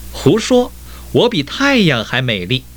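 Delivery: high-pitched, haughty storybook boast; proud retort. A narrator's reading. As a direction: snap back proudly, pitched well up. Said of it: "彩虹骄傲地说". (骄傲地高声反驳)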